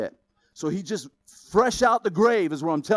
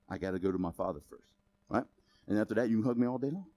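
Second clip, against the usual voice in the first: soft quick aside; 10 semitones lower, 9 dB quieter, much faster than usual. Retold or imitated. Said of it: "He was just".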